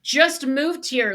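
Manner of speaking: usual speaking voice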